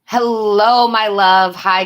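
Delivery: bright, loud, high hello; excited greeting. Real, not acted. To a viewer arriving in chat, loud and delighted. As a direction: call out a delighted welcome.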